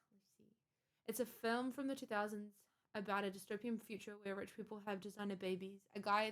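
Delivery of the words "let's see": a breathy whispered aside. Real, not acted. Streamer reading chat, whispered aside. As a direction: whisper it to yourself.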